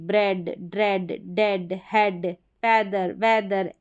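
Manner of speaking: ब्रेड, हेड said with a short e, not ee; slow, clear short e in हेड. Shown as a demonstration. Teacher drilling short e words, slowly. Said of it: "ए करके हो रहा है".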